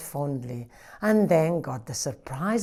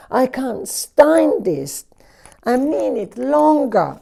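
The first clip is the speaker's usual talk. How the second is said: higher, slow and exasperated; fed-up genie; 8 semitones higher than usual. Acted. Genie fed up with the noisy farm, slow and exasperated, higher. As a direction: drag it out, at the end of your tether.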